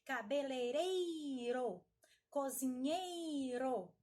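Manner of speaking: "cabeleireiro, cozinheiro" with a long, strong "ei"; slow teacher demo, dragging "ei" in "cabeleireiro, cozinheiro"; strong syllable drawn out. Shown as a demonstration. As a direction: stretch the stressed "ei" in "cabeleireiro, cozinheiro". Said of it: "Estas sílabas são mais fortes".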